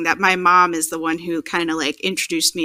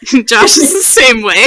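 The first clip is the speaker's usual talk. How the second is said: ordinary talk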